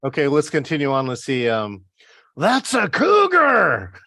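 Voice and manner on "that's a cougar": high, drawn-out movie-quote cry; mock alarmed yell. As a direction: belt out the film line, slowly and high. Acting out a movie line.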